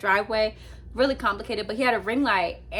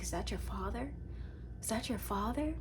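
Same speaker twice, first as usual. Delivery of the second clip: hushed question to cat; 13 dB quieter than usual. Retold or imitated. Asks the cat about another cat.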